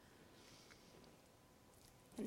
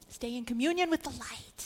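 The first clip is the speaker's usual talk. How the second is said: normal talking voice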